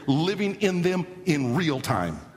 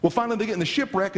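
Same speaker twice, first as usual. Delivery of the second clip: ordinary talk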